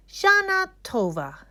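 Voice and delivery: high, bright holiday greeting; festive greeting. A narrator's reading. Sign greets the class for New Year, festive. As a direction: call out the greeting with joy.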